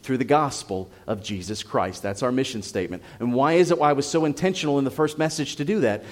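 ordinary delivery

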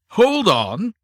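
sharp loud cry to halt; cried out. A narrator's reading. Superintendent cutting in, loud cry. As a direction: call out to stop him. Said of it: "cried the superintendent".

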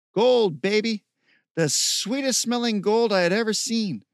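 loud, high-pitched gloating delight; exultant. A narrator's reading. Steve showing his gold to Michael, exultant. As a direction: relish the treasure, crowing it high and loud.

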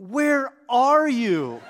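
loud, high, drawn-out mock demand; exasperated wife. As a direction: imitate a fed-up wife, stretching every word. Wife demanding where he has been.

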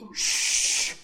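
long, hushing "shh"; shushing. Retold or imitated. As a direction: shush them like a father imitating God. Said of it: "And the Lord went".

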